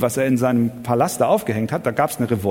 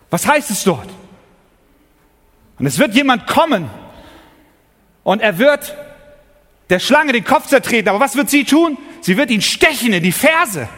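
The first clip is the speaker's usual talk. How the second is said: preaching at full force; 7 semitones higher than usual. Acted. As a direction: thunder it from the pulpit. Preaching climax about Satan's sting.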